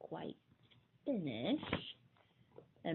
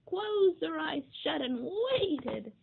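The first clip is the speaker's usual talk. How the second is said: plain reading voice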